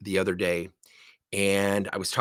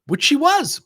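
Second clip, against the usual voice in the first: laughing aside; 5 dB louder, slower than usual. Real, not acted. Laughing punchline.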